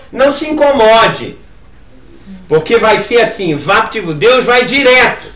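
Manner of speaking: emphatic preaching with stretched key phrases; forceful preaching. Real, not acted. Pastor telling congregation to live in the Spirit anywhere, forceful preaching. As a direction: urge the congregation on with conviction.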